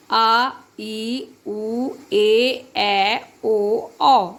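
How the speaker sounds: each vowel आ, ई, उ, ए, ऐ, ओ, औ held for two beats; long vowels demo. Shown as a demonstration. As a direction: stretch every vowel to double length for the class. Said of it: "आप देख ही रहे हैं". (दीर्घ स्वर को खींचकर बोलना)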